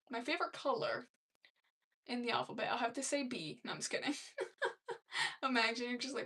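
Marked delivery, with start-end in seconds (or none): 4.2-5.4 high airy laugh. Real, not acted. Laughs at her own joke.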